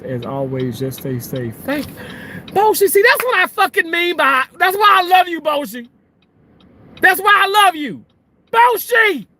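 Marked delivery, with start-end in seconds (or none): 1.6-9.3 very high, loud gushing outburst; excited shouting. Real, not acted. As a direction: shout your love at the top of your pitch. Calls out delighted thanks to viewer.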